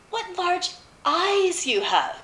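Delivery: very high, slow wonder; astonished girl. A narrator's reading. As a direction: draw it out high, amazed. Girl marvels at disguised wolf.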